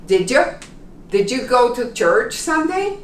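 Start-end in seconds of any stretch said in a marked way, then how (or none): 0.1-0.4 Did you with a reduced vowel like a. Shown as a demonstration. Teacher shows learners a relaxed Did you. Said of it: "it's almost like an A".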